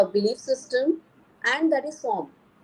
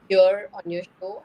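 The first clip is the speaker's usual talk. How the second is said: usual speaking voice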